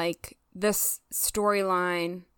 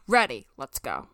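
low, firm and decisive; firm statement; 10 semitones lower than usual. Shown as a demonstration. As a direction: say it like you mean it, no doubt. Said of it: "It's firm".

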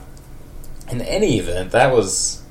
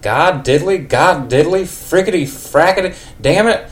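high, loud country cursing outburst; angry cursing; 9 semitones higher, 5 dB louder than usual. Acted. Ned curses loudly in anger at what he sees. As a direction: blow up and curse in a country twang. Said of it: "he cursed in anger".

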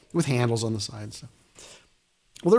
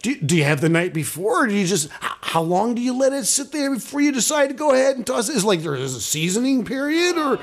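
incredulous rant; 11 semitones higher than usual. Acted. Ranting to his friend about the kept vomit, high and incredulous. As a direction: pile on the outrage, pitch climbing.

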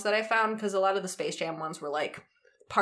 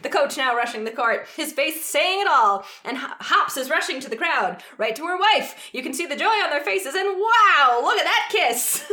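high, loud, ecstatic sports call; excited announcer; 10 semitones higher, 5 dB louder than usual. Shown as a demonstration. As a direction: scream the winning shot like a commentator. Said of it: "I'm gonna try and do my announcer voice".

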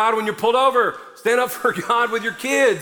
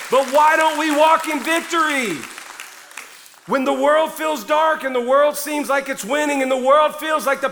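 loud, high, driving preacher's cry; full-force preaching; 7 semitones higher, 3 dB louder than usual. Acted. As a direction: preach it hard to the crowd.

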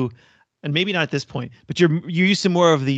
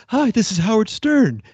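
higher, breathy put-on radio greeting; breathy Howard Stern; 6 semitones higher, 4 dB louder than usual. Fan mimicking the host's Private Parts voice, breathy. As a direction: imitate a breathy host introducing himself, pitched up. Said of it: "he makes his voice kind of breathy".

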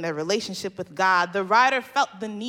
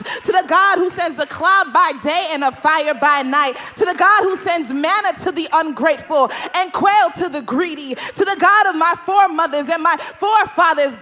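invocation that swells louder and higher line by line; building preaching; 5 semitones higher, 8 dB louder than usual. Acted. Preacher crying out to the God of Israel, rising and louder. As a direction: call on God, building to a peak.

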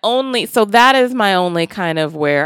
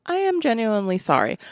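ordinary talk

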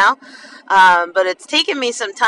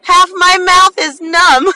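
high, loud, breaking into laughter; laughing at your own predicament; amused; 9 semitones higher, 5 dB louder than usual. Real, not acted. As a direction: blurt it out on the edge of a laugh. Laughing at own numb mouth.

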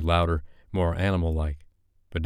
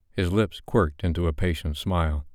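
usual speaking voice

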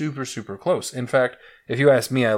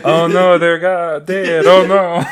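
hammed-up Egyptian accent, louder, the middle drawn out slowly; mock Egyptian accent; 5 semitones higher, 8 dB louder, much slower than usual. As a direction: fake Egyptian accent wailing over the dead. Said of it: "Say that again in an Egyptian accent".

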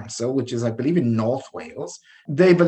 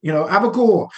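higher North Wales lilt; North Wales accent; 7 semitones higher than usual. Retold or imitated. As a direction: put on a North Wales accent, talk fast. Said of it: "for a North Wales accent".

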